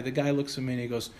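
ordinary talk

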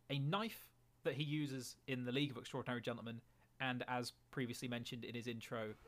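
ordinary delivery

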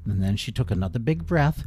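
plain reading voice

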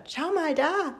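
high, loud amazed squeal; squealing surprise. Acted. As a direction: squeak it out in wonder. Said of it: "Frieda quiekte verwundert".

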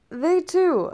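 ordinary delivery

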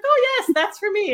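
high, chirpy mock-innocent; sweet innocent voice. Roommate brightly claiming the lingerie, innocent high voice. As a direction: play it cute and guilt-free.

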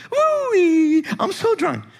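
very high, loud mock squeal; fake drunken whooping. Pastor mimics guest's wife faking Holy Ghost drunkenness, squealed drunken whoop. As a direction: imitate her putting on giddy drunkenness. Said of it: "wanted to be so drunk in the Holy Ghost, it was all fake".

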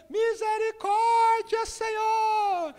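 very high, loud, long-drawn plea; hammed-up holler on a held high note; imitated congregant's cry. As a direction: wail it out slowly as mock church fervour. Congregant corrects cry in acted story.

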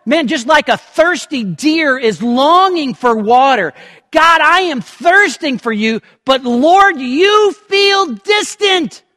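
high, loud, anguished plea; impassioned lament; crying out to God. Retold or imitated. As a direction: ache for a God who feels far. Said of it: "So he's crying out".

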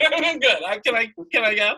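half laugh, half plead to be let go; laughing, mock pleading. To the construction lady, laughing, begging to leave. Retelling crying to construction worker.

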